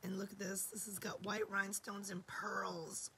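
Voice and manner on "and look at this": ordinary talk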